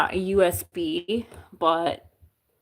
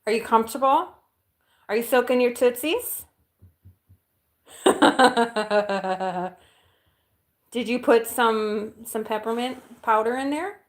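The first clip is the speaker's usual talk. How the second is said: talking to the dog; 5 semitones higher, 6 dB louder than usual. Real, not acted.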